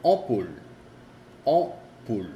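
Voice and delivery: ampoule with a nasal start; French ampoule, nasal; French demo word. Shown as a demonstration. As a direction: ampoule as o-poule, no m. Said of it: "hay una M porque hay una P".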